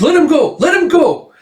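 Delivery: loud, very high repeated scream; frantic yelling plea; desperate shout. Acted. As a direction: scream it at the witch, begging. Hunter pleads as friend is taken.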